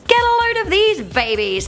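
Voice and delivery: high, bright pitchman voice; old-timey ad announcer. Pretend. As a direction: sell it like a vintage ad. Ad-style exclamation.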